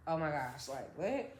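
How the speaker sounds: low, soft retold disbelief; hushed shock. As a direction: drop low and quiet, stunned. Retelling shock at seeing him.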